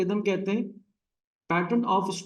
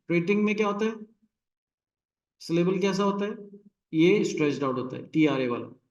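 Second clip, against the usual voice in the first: ordinary talk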